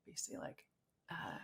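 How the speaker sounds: whisper. Real, not acted. Searching memory for a word.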